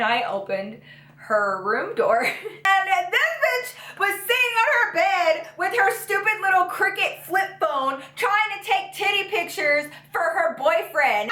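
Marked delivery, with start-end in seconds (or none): 2.4-3.1 sudden high laugh at what she saw; burst of laughter. Real, not acted. Laughing as she opens the door on her friend. 3.1-11.3 higher, half-shouting in disbelief; shocked outburst. Real, not acted. Telling viewers what her friend was doing, shocked.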